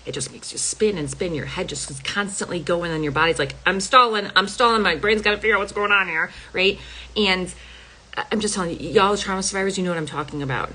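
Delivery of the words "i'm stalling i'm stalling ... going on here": higher, louder, rushing body in alarm; the body frantically stalls for time; panicked body voice. Retold or imitated.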